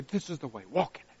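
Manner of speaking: hushed, soft voice of God; whisper. Retold or imitated. God whispering guidance to the listener, hushed. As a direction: breathe it like a secret. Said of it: "he whispers".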